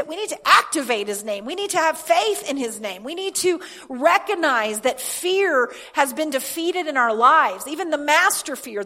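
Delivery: building declarations, voice lifting on key words; impassioned preaching. Real, not acted. Preacher tells congregation they are free from fear, impassioned preaching. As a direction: preach it with rising fervour.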